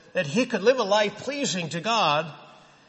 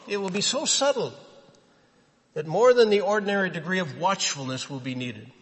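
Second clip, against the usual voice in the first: usual speaking voice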